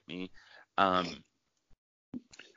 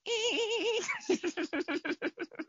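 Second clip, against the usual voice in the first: long high dolphin squeal; dolphin squeak. Pretend. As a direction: chirp like a dolphin. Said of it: "Are you trying to do a dolphin?".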